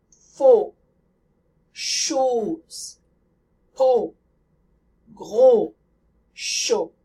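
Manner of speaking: Mot, Parole, Hôpital with lips rounded; French close O. Shown as a demonstration. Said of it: "you round your lips as you say the O sound".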